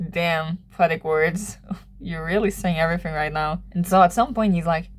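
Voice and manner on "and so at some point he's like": ordinary talk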